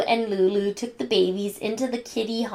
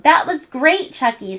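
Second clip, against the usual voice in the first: excited; 5 semitones higher, 4 dB louder than usual. Acted. Tommy excited.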